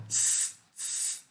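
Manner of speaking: voiceless hiss on "S, s"; long quiet s hiss; S sound. Shown as a demonstration. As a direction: let the air hiss through the teeth. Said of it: "ó".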